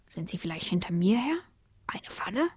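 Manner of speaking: tonloses, misstrauisches Flüstern; flüstere verdächtig zu dir selbst; flüsternd. Acted. Kater denkt misstrauisch. (Kater flüstert misstrauisch vor sich hin)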